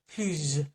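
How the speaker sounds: plus ending in a buzzing Z, pluz; French plus with a Z; plus with a Z sound. Shown as a demonstration. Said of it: "or as a Z sound".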